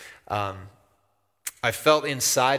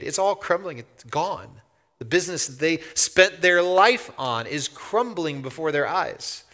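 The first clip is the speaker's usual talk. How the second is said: ordinary talk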